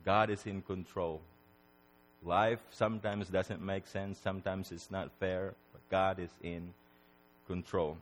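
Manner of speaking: low, hushed assurance; solemn reassurance. Real, not acted. Preacher reassuring listeners God is in control, hushed solemnity. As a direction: drop soft and low, let it settle.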